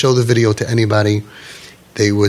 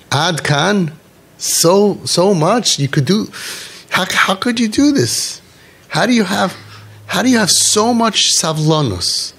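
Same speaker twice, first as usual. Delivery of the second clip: high-pitched, drawn-out disbelief; amazed questioning; 8 semitones higher, slower than usual. Retold or imitated. Grandson asking his grandfather about his patience, high-pitched amazement. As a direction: act out a grandson marvelling, stretching the words.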